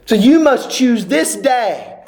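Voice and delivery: ordinary talk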